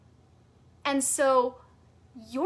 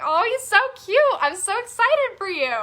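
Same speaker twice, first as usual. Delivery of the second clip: higher and much louder, delighted; squealing with delight at the post; gushing excitement; 8 semitones higher, 8 dB louder than usual. Retold or imitated. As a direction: gush over a cute baby photo. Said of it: "I was like".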